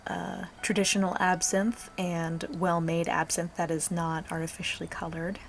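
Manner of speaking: low, sultry ASMR voice. Real, not acted. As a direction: speak low and intimate, ASMR style. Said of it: "talking in this, uh, low, slightly sultry voice".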